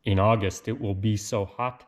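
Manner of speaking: usual speaking voice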